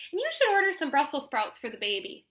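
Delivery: high, fussing grandma voice; mother a grown daughter at dinner; bossy grandma. Acted.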